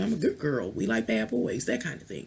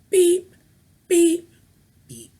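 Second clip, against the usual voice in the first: high mock beeps; ping out like a beacon; beacon beeping; 11 semitones higher, 7 dB louder, slower than usual. Calling herself a beacon with high, playful beeps. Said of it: "I'm a beacon".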